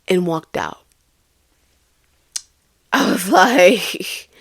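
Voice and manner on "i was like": laughing through tears. Real, not acted. Laughing-crying while recalling.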